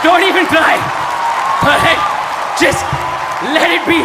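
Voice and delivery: loud, high yell at the audience; shouting down the hecklers; shouting over crowd. Real, not acted. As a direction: bark it over the noise.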